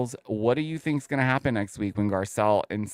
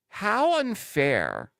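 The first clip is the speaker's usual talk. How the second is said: indignant; 8 semitones higher, much slower than usual. Real, not acted. Outraged aside.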